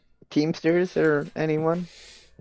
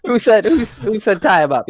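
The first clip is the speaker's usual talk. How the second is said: excited disbelief; 8 semitones higher, 4 dB louder than usual. Real, not acted. Party argues over tying.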